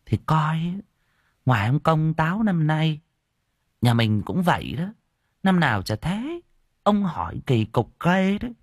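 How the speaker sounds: a hint of a teasing country wife; wife's voice. A narrator's reading.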